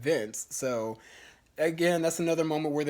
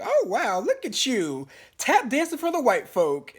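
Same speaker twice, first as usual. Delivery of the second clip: high-pitched, loud mock gushing; mock congratulations; 8 semitones higher, 4 dB louder than usual. Neighbour congratulates Issa on the event, mockingly gushing. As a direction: imitate a neighbour's over-the-top praise, pitched way up.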